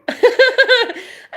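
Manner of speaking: loud, high cackle; high laughter. Real, not acted. Laughing after telling off the lady, high cackle.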